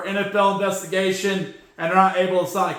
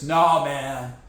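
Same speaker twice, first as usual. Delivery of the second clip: usual speaking voice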